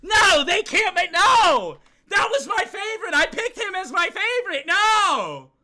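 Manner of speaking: despairing shouts. Real, not acted. Streamer watching his favorite die, loud despairing yells. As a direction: scream it at the screen, heartbroken. Favorite character dies in game.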